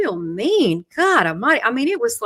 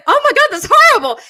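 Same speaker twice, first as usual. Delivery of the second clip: loud, high mock horror through laughter; retold shock, pitched way up; laughing shock; 9 dB louder, faster than usual. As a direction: exclaim it while cracking up. Retells shocked reply while laughing.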